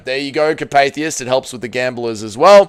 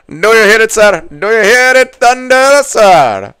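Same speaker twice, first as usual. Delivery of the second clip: higher, louder mock gentleman; silly put-on posh voice; 9 semitones higher, 8 dB louder than usual. Streamer riffing on the song for the boys in a posh voice. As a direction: ham it up with a "sir" on the end.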